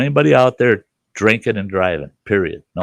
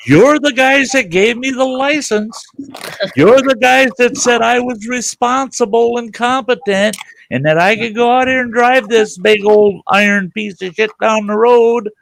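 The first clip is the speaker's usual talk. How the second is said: high, loud sustained harangue; indignant rant; 14 semitones higher, 5 dB louder than usual. Retold or imitated. To the cop who pulled him over, a loud indignant rant. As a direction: lecture the cop, outraged.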